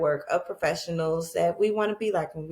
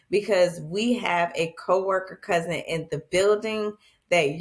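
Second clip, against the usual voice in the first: ordinary talk, nothing marked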